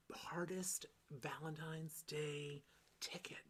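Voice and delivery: hushed excited whisper. Acted. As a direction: whisper it so nobody hears.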